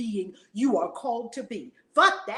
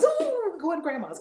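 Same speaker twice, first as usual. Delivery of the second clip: higher, sing-song delight; excited for grandma; 8 semitones higher, 4 dB louder than usual. Retold or imitated. Mother hyping her daughter about grandma's, playful excitement. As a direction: cheer like telling a child a treat. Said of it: "I said".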